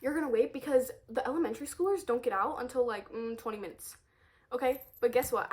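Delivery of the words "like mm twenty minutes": normal talking voice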